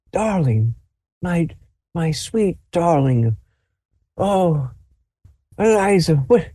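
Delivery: put-on high, slow, doting old grandfather voice; tender old man greeting his granddaughter, slow and high. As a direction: coo over her like a fond old grandpa.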